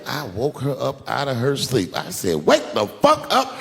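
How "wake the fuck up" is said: much higher, louder, slow demand; angry yell. Retold or imitated. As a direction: roar the question, furious. Angry husband yells.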